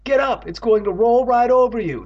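high, loud warning yell; urgent cry. A narrator's reading. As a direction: scream a panicked warning. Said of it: "Andy cried".

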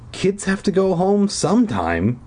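high-pitched dismissive insistence; insisting. A narrator's reading. Brother brushes off the disappearances, insisting. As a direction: insist it's nothing, voice lifted. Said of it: "he insisted".

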